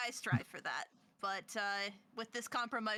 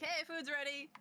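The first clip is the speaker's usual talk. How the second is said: light yell to the next room, a bit higher; calling out; 6 semitones higher than usual. Acted. Cook calls the others in to eat, calling out. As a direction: call out with a raised pitch, not full volume. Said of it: "yell to the living room".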